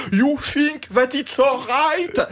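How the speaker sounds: put-on slow, high-pitched English with a French accent. Comic imitating an irritating passenger, French-accented English. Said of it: "parle anglais avec un accent français".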